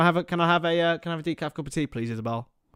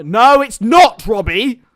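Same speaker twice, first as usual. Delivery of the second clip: loud high it's not; loud indignant not; shouted denial; 6 semitones higher, 12 dB louder, slower than usual. Real, not acted. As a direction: shout the correction at Robbie. Shouting at chat.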